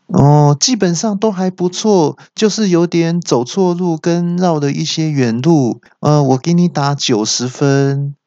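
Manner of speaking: lightly voiced, high navigator giving a review; navigator passing judgement. Navigator grades the driver.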